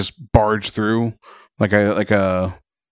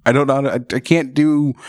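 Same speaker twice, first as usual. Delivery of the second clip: normal talking voice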